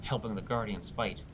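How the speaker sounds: usual speaking voice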